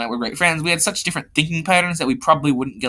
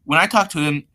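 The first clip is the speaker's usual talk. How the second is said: ordinary delivery, nothing marked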